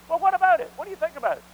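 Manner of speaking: high, loud challenge to the room; preacher calling out. Real, not acted. Preacher challenging his congregation, loud and high. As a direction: fire the question out at them.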